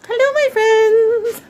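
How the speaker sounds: high, slow, drawn-out hello; sing-song greeting. Pretend.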